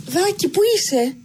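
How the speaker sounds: high, worried mother's call; lightly voiced mother searching for her boy. A narrator's reading. Mother still calling for her missing son, high and worried. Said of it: "Η μάνα εξακολουθούσε να φωνάζει".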